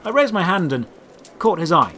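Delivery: ordinary delivery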